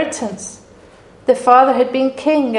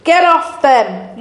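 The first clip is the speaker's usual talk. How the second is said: slower shout; 4 semitones higher, 5 dB louder, slower than usual. Retold or imitated. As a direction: stress on get, louder and slow. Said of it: "screaming and shouting".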